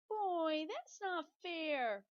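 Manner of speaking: high, loud, slow whine; whining pigtail. Acted. Pigtail complaining to Paul, in a high drawn-out whine. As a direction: stretch out the complaint like a sulky child. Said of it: "whined the pigtail".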